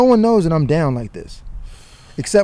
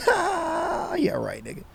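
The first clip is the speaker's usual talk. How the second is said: hysterical laugh; 9 dB quieter, slower than usual. Retold or imitated. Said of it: "Like a hysterical laugh".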